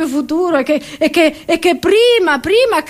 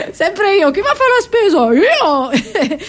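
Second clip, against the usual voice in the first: high, laughing question and proud answer; laughing self-answer; 6 semitones higher, slower than usual. Real, not acted. Comedian answering her own question, laughing and high. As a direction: giggle through it, then claim it.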